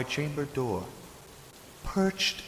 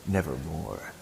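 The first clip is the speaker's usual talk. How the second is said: raven's reply; 7 semitones lower, 4 dB quieter than usual. Acted. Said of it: "Then the bird said".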